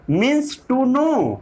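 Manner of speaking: each word drawn out slowly for note-taking; slow dictation. Shown as a demonstration. Teacher dictating to students, slow and deliberate. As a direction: spell it out word by word so they can write.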